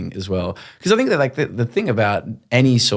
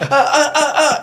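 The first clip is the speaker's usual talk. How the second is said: high, loud stammering mock panic; panicked stammer; 3 dB louder than usual. Host frozen on air after the joke, stammering high and loud. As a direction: stutter in high panic, lost for words. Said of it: "And I'm like".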